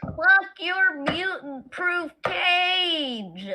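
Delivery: slow furious yell; angry shout. Acted. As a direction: rage at the cage. Player raging at cage.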